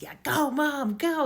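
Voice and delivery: loud, high cheer of encouragement. Retold or imitated. Son cheers his mom as she finally stands, loud and high. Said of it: "He's just cheering her on".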